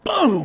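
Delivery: very loud, high "Boom!" like a body blow; imitated thunderous punch; uppercut impact sound. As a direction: blast out the hit. Acting out an uppercut landing.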